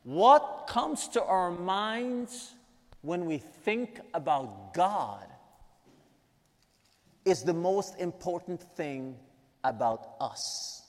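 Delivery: slow, deliberate repeat, each phrase weighed; said again slowly. Shown as a demonstration. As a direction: let the quote sink in word by word. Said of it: "Let me say that again".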